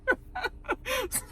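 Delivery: soft, high wheezy laugh. Real, not acted. Amused by the threat just retold, laughing. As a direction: laugh quietly at your own retelling.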